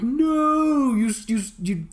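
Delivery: desperate plea. Retold or imitated. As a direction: beg him not to hang up. Pleading with seller in retold quote.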